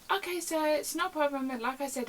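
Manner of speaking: imitated apologetic call handler; placating phone voice. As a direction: placate a rude caller, brightly polite. Placating the rude caller again.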